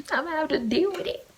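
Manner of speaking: high playful repeat; high put-on voice. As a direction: say it again in a high silly voice. Repeats line in new voice.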